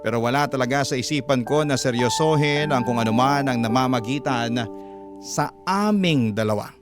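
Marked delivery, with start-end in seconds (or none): none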